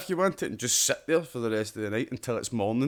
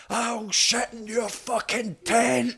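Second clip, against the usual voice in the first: high, slow, strained mock scream; retold scream; 10 semitones higher, much slower than usual. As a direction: squeal it out like a startled yell. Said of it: "I was just like".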